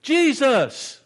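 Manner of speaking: loud high cry of Jesus!; shouted name. Retold or imitated. Rowdy man crying out to Jesus at the altar, shouting. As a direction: call out Jesus! with everything. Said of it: "all of a sudden he said".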